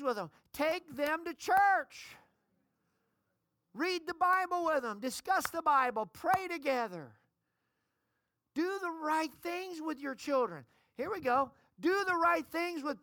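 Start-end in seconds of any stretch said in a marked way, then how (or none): 0.5-10.7 emphatic preaching. Acted. Preaching list of duties.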